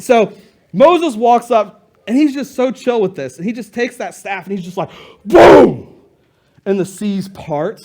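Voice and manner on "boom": sudden loud, drawn-out boom; one big explosive hit; booming exclamation. Acted. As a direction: burst out with a dramatic impact sound. Imitates Moses striking with the staff.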